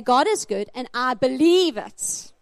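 slow declaration, BELIEVE drawn out loud; stress on BELIEVE. Shown as a demonstration. Preacher tells them to say it to their neighbor, stress on BELIEVE.

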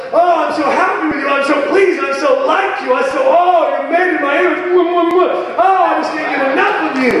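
high, gushing delight; high, fast doting coo; delighted God voice. Acted. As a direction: gush over them like a doting parent.

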